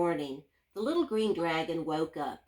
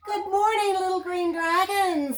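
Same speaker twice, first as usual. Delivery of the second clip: much higher, cheery townsfolk greeting; cheerful townsfolk; 10 semitones higher, 4 dB louder than usual. Acted. Townspeople greeting the dragon, high and cheery. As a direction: sing out a bright good morning. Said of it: "said the people".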